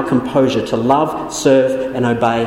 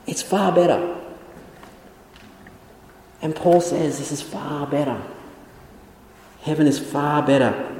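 hushed emphasis; 6 dB quieter than usual. Acted. Quiet emphatic contrast after rebuke.

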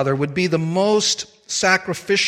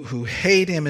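normal talking voice

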